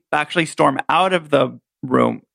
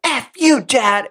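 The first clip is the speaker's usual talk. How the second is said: retold yelling; 7 semitones higher, slower than usual. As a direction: yell it at dad like a teen. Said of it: "they're yelling in the other room".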